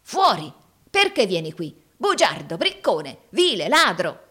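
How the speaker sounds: angry, loud accusations; furious dismissal breaking into name-calling; through gritted teeth. A narrator's reading. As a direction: hurl each insult through clenched teeth. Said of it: "digrignando letteralmente i denti".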